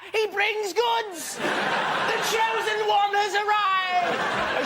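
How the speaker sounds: high, drawn-out proclamation; grand herald. Acted. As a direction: proclaim it like a royal herald, slowly. Acted grand herald hailing the donor.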